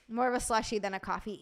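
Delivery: normal talking voice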